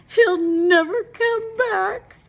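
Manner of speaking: high, loud, very slow lament; despairing wife. A narrator's reading. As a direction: wail it, fearing he's gone. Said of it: "thought Missus Wagon Wheel".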